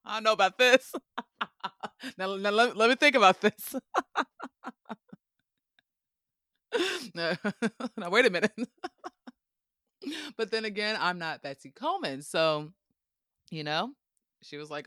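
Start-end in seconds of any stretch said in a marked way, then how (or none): 0.0-8.5 loud, laughing second thoughts; laughing protest. Acted. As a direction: back out while cracking up.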